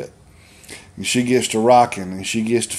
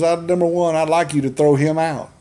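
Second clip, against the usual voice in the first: imitated mother; 5 semitones higher than usual. Mother answers preacher in story.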